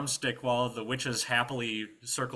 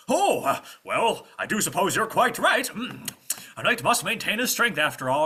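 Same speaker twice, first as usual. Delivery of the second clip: high, blustery, easily persuaded knight; pompous knight; 10 semitones higher, 9 dB louder than usual. Acted. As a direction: concede grandly as if doing your duty. Knight happily agrees to eat.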